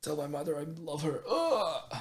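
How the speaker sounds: hammed-up dying last words. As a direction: fade out like a dying hero. Mock last words when asked.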